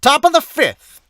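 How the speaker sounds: high, loud stadium announcer call; high, loud ballpark announcer call; inning announcer voice. Shown as a demonstration. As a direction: boom it out like a baseball announcer over the PA. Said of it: "I'm gonna use that voice to do it".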